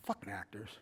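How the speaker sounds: very soft, low janitor's grumble; imitated low, quiet muttering; muttered disdain. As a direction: grumble it under the breath. Retelling the janitor's muttered reaction.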